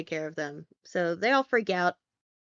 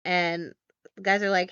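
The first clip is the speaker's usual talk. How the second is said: ordinary delivery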